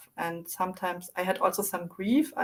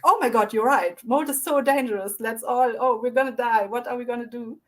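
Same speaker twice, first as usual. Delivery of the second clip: higher, louder, hammed-up freak out; mock panic; 4 semitones higher, 5 dB louder than usual. Panicking adults react to scared child, hammed-up panic. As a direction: act the adults panicking together. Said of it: "jumping up and freaking out as well".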